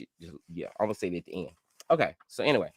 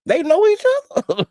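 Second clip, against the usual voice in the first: much higher and louder, gasping "They know each other?"; stunned disbelief; 13 dB louder than usual. Retold or imitated. To viewers, retelling finding out two creators know each other, a loud shocked shriek. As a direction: shriek it like the news floors you. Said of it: "'cause I was like".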